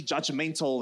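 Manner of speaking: ordinary talk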